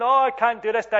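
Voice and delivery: high, loud mock complaint; lightly acted protest to Dad; crying child. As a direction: imitate a tearful child giving up. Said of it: "every time she's crying".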